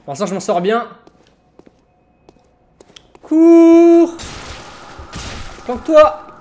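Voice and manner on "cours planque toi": loud, high urgent commands; urgent shout. Real, not acted. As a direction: bark it in a rush. Urging himself to flee and hide.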